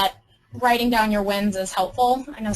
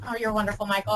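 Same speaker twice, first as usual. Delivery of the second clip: ordinary talk, nothing marked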